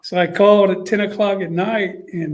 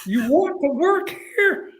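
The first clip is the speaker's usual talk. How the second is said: high-pitched, slowing repeat; much higher coach voice; Coach Spurrier impression; 11 semitones higher than usual. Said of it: "That's what he asked me".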